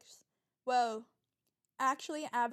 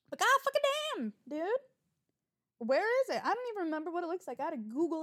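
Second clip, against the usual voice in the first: much higher, louder and fast venting; exasperated outburst; 6 semitones higher, 6 dB louder than usual. Real, not acted. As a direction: blow up in frustration. Venting about car delays.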